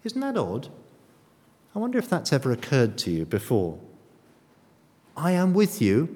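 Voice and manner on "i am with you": with stressed, louder; emphatic promise. Shown as a demonstration. Preacher quoting Jesus's promise with weight. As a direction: say it firm and loud. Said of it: "laying it on really heavy".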